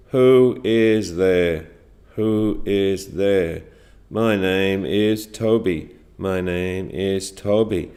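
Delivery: low, slow monotone with no lift; boring delivery. Shown as a demonstration. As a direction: recite it line by line, dull and droning. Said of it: "that was a very boring way to say it".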